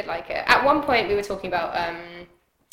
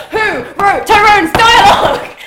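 loud, high, drawn-out laughing shout; incredulous outburst; 11 semitones higher, 14 dB louder, slower than usual. Real, not acted. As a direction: cry it out through laughter, aghast at the writing. Incredulous, laughing outburst at bad dialogue.

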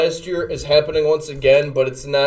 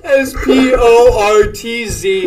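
loud; 7 semitones higher, 7 dB louder, slower than usual. Real, not acted. Spelling promo code loudly.

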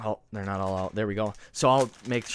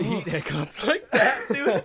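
laughing; 8 semitones higher, 3 dB louder, slower than usual. Real, not acted. Hiccuping, burnt, laughing.

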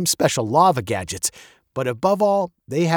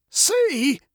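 put-on high, gravelly old man's voice; testy old man, high and gravelly; 14 semitones higher than usual. A narrator's reading. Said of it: "his voice as old and gravelly as his face".

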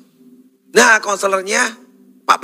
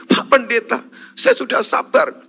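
higher-pitched imitation of a distressed woman; mock tearful complaint; 6 semitones higher than usual. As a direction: plead with the pastor, lightly acted. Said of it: "Ibu-ibu nangis-nangis".

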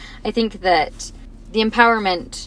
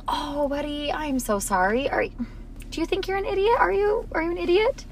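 soft and much higher, gently soothing; tender mom; 8 semitones higher, 6 dB quieter than usual. Retold or imitated. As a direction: coo it to the hurt boy. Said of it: "And I was like".

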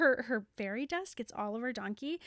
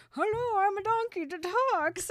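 higher, put-on donkey voice; mock ventriloquist for the donkey; lady faking a talking donkey voice; putting on a voice; 7 semitones higher, 3 dB louder than usual. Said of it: "is like".

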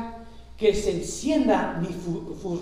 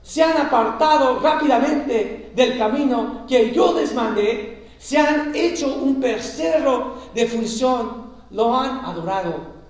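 loud, fervent scripture reading; booming pulpit delivery, slowed on the key words; preaching at full force; 5 semitones higher, 8 dB louder than usual. Acted. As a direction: proclaim God's rebuke to the congregation. Preacher reads God's words loudly.

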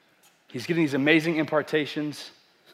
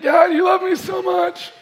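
much higher, louder, slow tearful cry; 6 dB louder than usual. Retold or imitated. Pastor reenacts crying to God in his house, tearful loud wail. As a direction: wail it out, overcome with tears.

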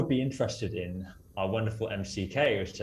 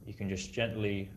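calm grounding exercise; 7 dB quieter than usual. Acted. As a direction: gently bring listeners back. Grounding back to the room.

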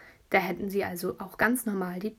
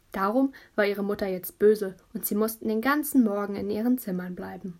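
ordinary delivery

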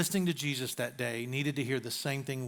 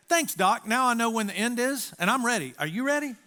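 higher-pitched, eager quoted reply; mentor's bright-spirited retort, pitched up; upbeat readiness; 6 semitones higher than usual. Retold or imitated. Mentor telling the doctor he is ready to die, upbeat.

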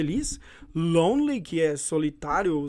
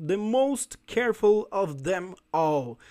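slow model reading; slower than usual. Shown as a demonstration. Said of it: "teacher models the phrase".

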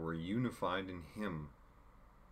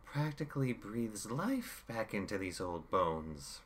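villain Chaos; 3 semitones higher than usual. Acted. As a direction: savour each word like a gloating villain. Chaos voiced in audiobook.

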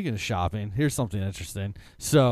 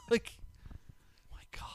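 laugh, then whisper my God; breathless laughing exclaim; laughing disbelief; 11 semitones higher, 9 dB quieter, faster than usual. Real, not acted. Laughing disbelief at mall wedding.

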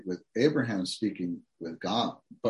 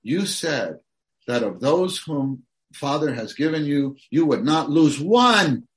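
put-on preacher modelling a bold prayer for a drifted child; bold intercessory prayer; 7 semitones higher, 7 dB louder than usual. Shown as a demonstration. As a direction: pray boldly, holding God to his word. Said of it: "We have the ground to say".

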